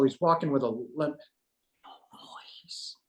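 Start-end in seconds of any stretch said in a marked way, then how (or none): 1.8-3.0 barely voiced whisper; very soft, breath-only aside; hushed amazement. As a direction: whisper it in awe, retelling the moment. Amazed at the man's silent approach.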